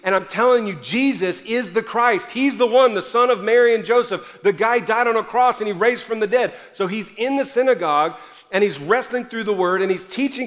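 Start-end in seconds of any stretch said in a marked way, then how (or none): 0.0-6.5 preaching. Acted. Pastor proclaiming to the congregation, fervent preaching. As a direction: proclaim it to the whole church. Opening preaching at full force.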